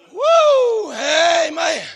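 high, loud whoop and amen; joyful whoop. Real, not acted. Preacher whooping amen to the church. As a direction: cry out in praise.

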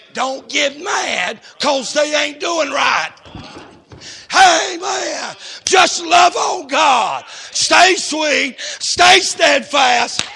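preaching at full force. Acted. Preacher shouting slow commands to the church. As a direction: stretch each command out.

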